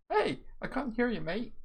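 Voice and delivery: high mocking squadmate voice. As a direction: squeak it out like a careless mate. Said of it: "you can't just say".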